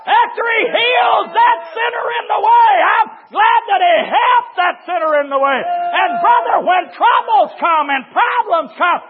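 high, shouted preaching; full-force preaching. Real, not acted. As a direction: shout it to the whole congregation with fervor.

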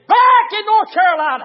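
loud, high pulpit shout; exclaimed retold news. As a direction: cry out the caller's big news. Caller's news cried out in preaching.

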